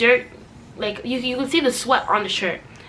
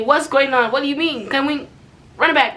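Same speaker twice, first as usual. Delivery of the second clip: usual speaking voice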